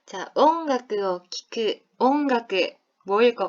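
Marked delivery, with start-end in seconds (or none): 0.3-1.8 音楽を聴く said at a crawl; very slow. Shown as a demonstration. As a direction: stretch every syllable of 音楽を聴く. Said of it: "modeling phrase slowly". (아주 천천히 또박또박)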